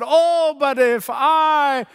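high, slow, stretched-out plea; imitated crying out. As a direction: cry out the wish, drawing each word out. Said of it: "Then he cried out".

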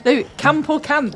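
slow, laughing northern accent attempt on cant. Shown as a demonstration. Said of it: "give it your best northern accent".

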